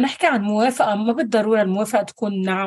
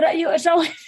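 quick, higher, laughing through the words; light laugh; 6 semitones higher, much faster than usual. Real, not acted. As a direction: rush it out with a giggle. Laughs while explaining changing mind. (ضحكة خفيفة وكلام سريع)

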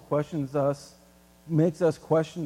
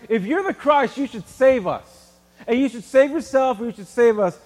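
loud mocking taunt, a bit raised; mock yelling thief; 4 semitones higher, 6 dB louder than usual. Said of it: "he starts yelling at Jesus".

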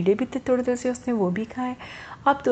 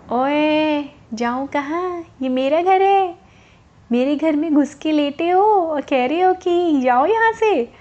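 higher, louder indignant scolding; angry bird; 5 semitones higher, 3 dB louder than usual. Acted. Bird scolding the rabbit sleeping in her home, higher indignant shout. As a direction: tell the intruder off from your own doorway. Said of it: "देखा तो उसको गुस्सा आया".

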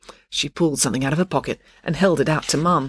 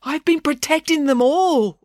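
high, slow, strained declaration; urgent protest; 11 semitones higher, 4 dB louder than usual. Acted. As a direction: insist with the last of your breath. Wounded Maynard protests accusation.

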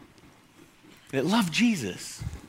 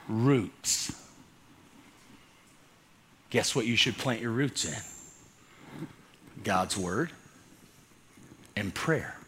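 much softer and lower, slow; hushed sermon point; 10 semitones lower, 10 dB quieter, much slower than usual. Real, not acted. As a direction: drop the voice to draw them in. Soft dramatic teaching point.

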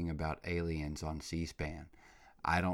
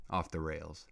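ordinary delivery

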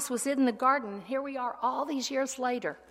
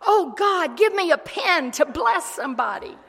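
loud, high mock prayer; 7 semitones higher, 10 dB louder than usual. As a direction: ham up a showy prayer.

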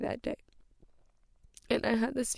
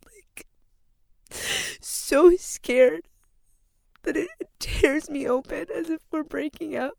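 higher, louder voice breaking into tears; sobbing out the words for real; crying; 4 semitones higher, 4 dB louder than usual. Real, not acted. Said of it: "I can't stop crying".

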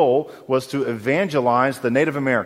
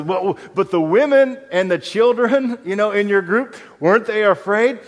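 high, louder disbelieving Wesley; incredulous raised question; 8 semitones higher, 5 dB louder than usual. Retold or imitated. Wesley pressing the Moravian about women and children, amazed. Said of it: "And Wesley says".

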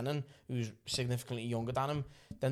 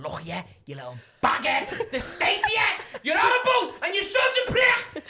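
screaming at her; 8 dB louder than usual. Acted. Man abusing the neighbour in the street, screaming. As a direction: rant building to a high-pitched scream. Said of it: "I'm screaming at her".

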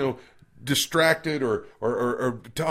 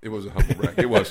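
laughing admission; 4 dB louder than usual. Real, not acted. Admitting the humble brag.

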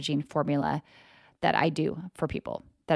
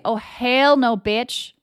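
sassy, loud, raised-pitch clapback; defiant comeback; 6 semitones higher, 5 dB louder, slower than usual. Pretend. To her own old belief, a defiant sassy refusal. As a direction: slam the door on an old belief with attitude. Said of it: "That's when you choose to say".

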